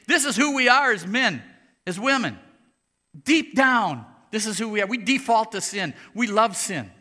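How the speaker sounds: loud, high, urgent sermon voice; fiery preaching. Acted. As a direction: preach it with full force. Preaching at full force.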